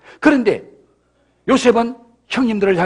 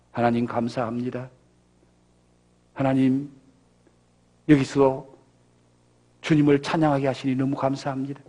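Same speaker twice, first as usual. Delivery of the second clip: soft, much lower thankful prayer; quiet grateful prayer; 8 semitones lower, 6 dB quieter than usual. Pretend. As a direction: pray gently, full of thanks. Paul praising God in prison. (조용하고 감사에 찬 기도)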